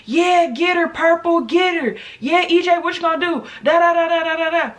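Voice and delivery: high, loud imitated kids egging on a fight; hyped crowd. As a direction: play the rowdy crowd cheering for a fight.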